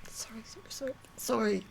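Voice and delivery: whispered sorry through real tears; breathing out apologies while crying; crying apology. Real, not acted. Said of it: "I thought I'll be able to talk more calmly".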